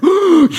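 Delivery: mock gasp. Drowned man resurfacing.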